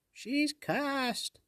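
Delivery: very high, loud sprite cry; shouting fairy. Acted. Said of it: "the sprite shouted".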